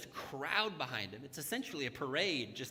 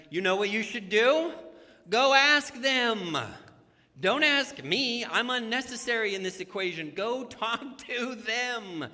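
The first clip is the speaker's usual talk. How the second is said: preaching at full force; 6 semitones higher, 9 dB louder than usual. Real, not acted. Pastor urging the church to ask directly, preaching loud. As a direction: build to a loud peak.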